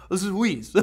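light mock French accent. Shown as a demonstration. Host joking about how to say Suisse, mock French accent. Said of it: "say it with a French accent".

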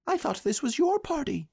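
very high little-animal voice; Piglet. A narrator's reading. Piglet puzzled, asking Pooh, high small voice. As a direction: speak as a tiny, squeaky creature. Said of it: "Piglet whispered to Pooh".

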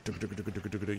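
soft, rapid-fire chugging sound effect; imitated cranking noise. Game master mimics villagers lifting the drawbridge, soft chugging. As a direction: patter out a mechanical clatter, softly.

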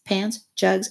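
ordinary delivery

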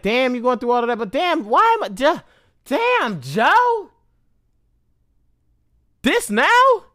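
high-pitched disbelief, replaying the moment; incredulous reaction. Storyteller replaying a shocked thought about Joe, incredulous.

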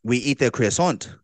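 a hammed-up French accent, tossed-off phrase; playing a Frenchman in a mock French accent. Goofing for his friend in a mock French accent. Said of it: "that's your French accent".

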